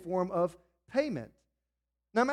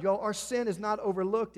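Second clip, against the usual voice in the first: usual speaking voice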